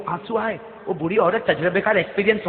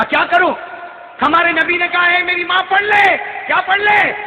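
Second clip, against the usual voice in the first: high, forceful exchange; impassioned preaching; 11 semitones higher, 7 dB louder than usual. Acted. Prophet telling his mother to recite, impassioned. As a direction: press it urgently and high.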